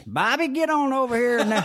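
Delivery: high, twangy Southern man calling out; high-pitched Southerner. Retold or imitated. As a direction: sing it out like a high-voiced Southern good ol' boy. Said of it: "one of those high-pitched Southerners".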